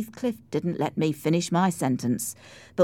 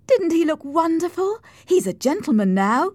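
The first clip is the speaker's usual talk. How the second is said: high, loud, thrilled voice; gushing admiration; 12 semitones higher, 5 dB louder than usual. Acted. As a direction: gush about the man she adores. Cathy gushes about Heathcliff.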